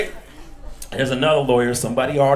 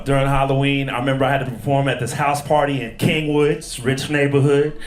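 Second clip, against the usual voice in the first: ordinary delivery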